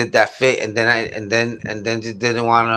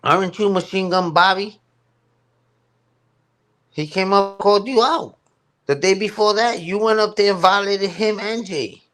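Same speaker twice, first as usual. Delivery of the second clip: pitched way up, pressing the question hard; higher and higher as the accusation builds; heated callout; 8 semitones higher than usual. Real, not acted. Calling out O G on stream, pitched high and heated.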